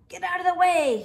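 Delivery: loud, high yell from a sheep; angry sheep yelling at the duck to move. Acted. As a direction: bellow it out of the Jeep window. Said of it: "he yells with a beep".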